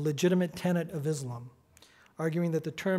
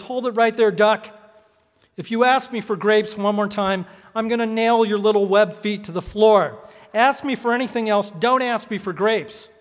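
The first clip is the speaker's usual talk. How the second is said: loud, raised-pitch mock tirade; threatening manager; 3 semitones higher, 7 dB louder than usual. Manager threatening a pestering duck, loudly angry. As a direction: lay down the law as an irate storekeeper.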